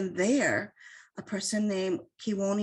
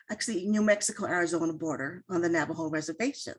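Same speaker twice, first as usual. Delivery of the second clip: ordinary talk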